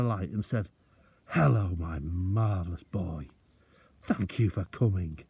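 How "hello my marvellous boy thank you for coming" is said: tender greeting. Acted. Injured father greets son.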